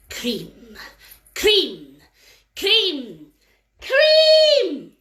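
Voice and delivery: demanding fairy chant growing to a shout; fairies demanding cream. Acted. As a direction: chant it, rising to a yell.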